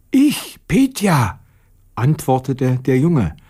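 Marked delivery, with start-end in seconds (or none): 0.1-1.4 put-on lightly hoarse boy, pitched high. A narrator's reading. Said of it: "was hast du bloß heute für eine heisere Stimme". (leicht heisere, hohe Stimme)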